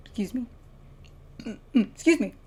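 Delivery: talking through burps; words broken by a burp. Real, not acted. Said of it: "Keep burping".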